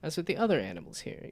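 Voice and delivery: usual speaking voice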